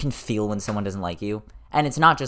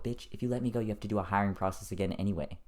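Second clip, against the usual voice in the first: muttered aside; 2 semitones lower, 8 dB quieter, faster than usual. Real, not acted. Muttered comeback to the boss.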